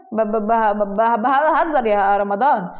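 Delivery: slow, stuttering backtrack; nervous stammer. Acted. Omar backs down from the threat, nervous stammer. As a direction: stammer on the first sound, then drag it out.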